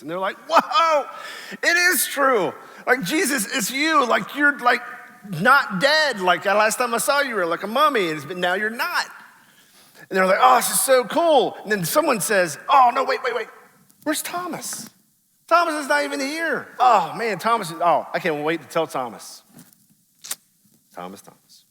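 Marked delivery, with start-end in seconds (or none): 0.5-9.1 high, loud, astonished gush; stunned disciples seeing him alive; amazed. Retold or imitated. 10.4-11.6 high, loud excited gush; thrilled. Retold or imitated. Disciples thrilled. 12.7-19.4 high, urgent, giddy; suddenly remember, eager to tell; excited realization. Retold or imitated. Disciple remembering Thomas absent. 20.9-21.6 much softer, low murmur; fond sigh. Real, not acted. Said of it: "fond head-shaking aside".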